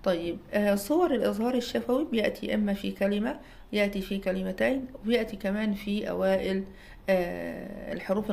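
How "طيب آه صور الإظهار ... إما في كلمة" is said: usual speaking voice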